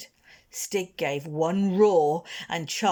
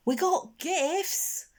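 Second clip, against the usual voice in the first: put-on timid boy; 12 semitones higher, much slower than usual.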